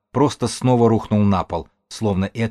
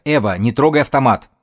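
higher, louder warning cry; urgent shout; 4 semitones higher, 5 dB louder than usual. A narrator's reading. Белка orders Эва off the gun, shouting. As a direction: shout to stop her at once. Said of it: "крикнула Белка".

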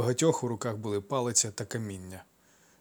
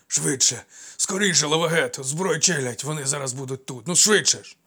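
loud panicked calling out; urgent shout; 4 semitones higher, 7 dB louder than usual. Acted. Running servant shouts at his master to hurry. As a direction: yell at him to hurry, out of breath. Gundos runs up, urging loudly.

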